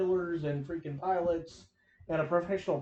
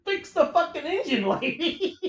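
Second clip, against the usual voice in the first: louder, higher exasperated yell through laughter; mock yell; 9 semitones higher, 6 dB louder than usual. Host venting at a TV engineer, laughing mock yell. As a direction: bark it at her half-laughing.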